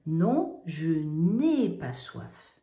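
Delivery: loud slow NON then je n'ai pas soif, n'ai stressed; teacher modelling the negative to class, slow and loud; emphatic slow negation. Shown as a demonstration. Slow, stressed "je n'ai pas soif" with loud "Non". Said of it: "je dis non".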